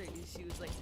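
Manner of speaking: ordinary delivery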